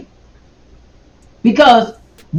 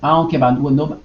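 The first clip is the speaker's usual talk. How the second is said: normal talking voice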